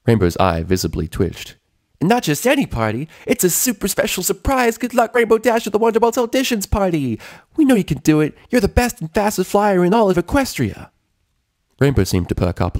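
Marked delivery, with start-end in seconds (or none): 2.0-10.9 boisterous Pinkie. A narrator's reading. Pinkie Pie cheering up gloomy Rainbow, boisterous. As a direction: bounce in full of party energy. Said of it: "an ever-boisterous Pinkie Pie".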